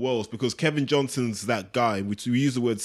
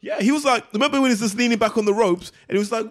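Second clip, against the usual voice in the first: excited; 10 semitones higher, 4 dB louder, faster than usual. Real, not acted. Excited retelling.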